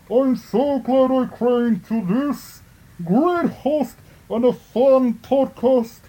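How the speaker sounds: hammed-up Bane voice; Bane impression. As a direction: read it as Bane, drawn out. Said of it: "I'll read mine in the voice of Bane".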